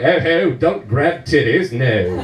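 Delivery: put-on British accent, mock objection; mock-British reproach, dropping low at the end. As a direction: imitate a British passenger protesting. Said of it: "on the plane with British people".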